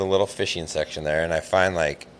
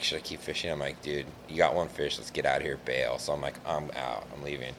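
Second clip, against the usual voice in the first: quiet inner voice; 6 semitones lower, 13 dB quieter than usual. Retold or imitated. Retold inner talk fleeing goose.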